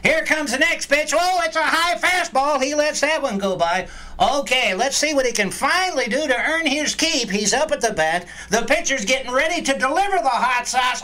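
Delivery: high, excited play-by-play call; baseball announcer voice. Pretend. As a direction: call the game like a ballpark announcer. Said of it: "your best baseball announcer voice".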